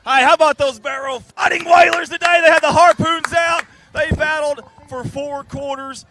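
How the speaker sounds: big hype shout, high and loud; loud cheering. Real, not acted. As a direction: shout it like a stadium announcer. Cheering the home team win.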